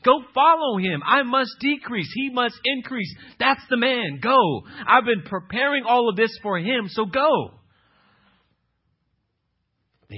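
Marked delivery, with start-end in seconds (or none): 0.0-7.6 higher and louder, slow, urgent prophet sending them away; John the Baptist insisting. Acted. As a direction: play a prophet pushing his followers off to another. Preacher voices John the Baptist.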